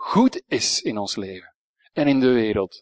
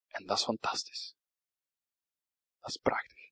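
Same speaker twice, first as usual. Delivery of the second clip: whisper; 2 semitones lower, 13 dB quieter than usual. Real, not acted. Awed aside after the good news.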